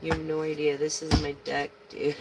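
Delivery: ordinary talk, nothing marked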